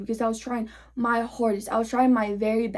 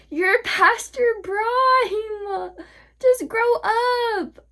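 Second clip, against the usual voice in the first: very high, long drawn-out mock cry; mocking wail; 10 semitones higher, much slower than usual. Real, not acted. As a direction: wail it slowly to taunt them. Taunting repeat, mock wailing.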